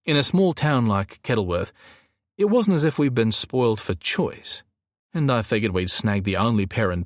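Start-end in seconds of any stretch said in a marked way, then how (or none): none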